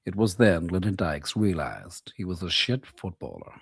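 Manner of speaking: deep, unhurried film-narrator gravitas; Morgan Freeman. Retold or imitated. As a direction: narrate it like a grave documentary voice-over. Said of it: "We did, we did the old Morgan Freeman".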